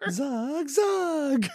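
high soft sung reply; lightly voiced [name]. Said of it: "[name]'s beautiful voice comes in".

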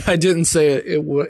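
ordinary talk, nothing marked

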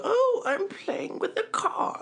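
high, slow, posh-lady voice; Queen impression. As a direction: mimic the Queen playing. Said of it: "get the Queen of England".

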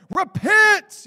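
hammed-up doom cry; mock street preacher. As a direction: yell it like a fire-and-brimstone sign-waver. Mocking judgmental street preacher.

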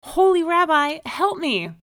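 high, loud cry for help; screamed plea. A narrator's reading. Desperate man begging the rabbi's help, screamed plea. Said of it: "he screamed".

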